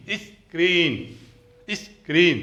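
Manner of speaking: स्क्रीन split as 'is... kreen'; 'is', a small gap, 'kreen' for स्क्रीन; leading 'is' sound. Shown as a demonstration. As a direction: sound out स्क्रीन in two parts, 'is' first. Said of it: "देखिए sound देखिए".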